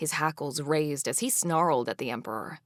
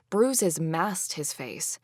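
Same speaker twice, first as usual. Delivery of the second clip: usual speaking voice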